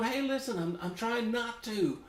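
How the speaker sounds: high-pitched mock self-defense; retold pleading protest. Teased man defending his accent to his buddy, mock pleading. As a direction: plead your case high, acting it out. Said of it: "And I'm going".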